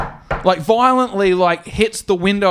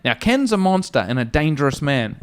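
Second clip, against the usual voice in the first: ordinary talk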